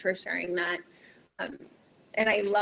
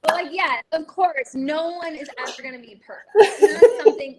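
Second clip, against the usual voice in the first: laughing while talking; 6 semitones higher, 11 dB louder than usual. Real, not acted. Host laughing after her joke.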